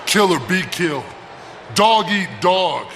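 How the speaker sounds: ordinary talk, nothing marked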